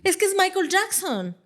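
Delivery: high-pitched parent making excuses; mock starstruck parent. Mother justifying leaving her son, mock starstruck.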